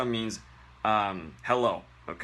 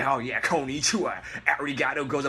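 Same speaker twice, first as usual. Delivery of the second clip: smug wannabe; 3 semitones higher than usual. Retold or imitated. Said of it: "it's like".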